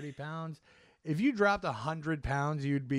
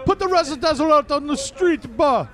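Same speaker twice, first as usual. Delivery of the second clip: much higher, much louder Jamaican-London slang; London roadman accent; 14 semitones higher, 17 dB louder than usual. Retold or imitated. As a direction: hype it up like a roadman on the block. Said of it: "now they speak like Jamaicans".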